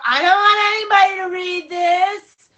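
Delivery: high, loud whiny protest; whiny objection. Retold or imitated. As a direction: mimic a stubborn listener refusing. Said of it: "before you shut me down and go".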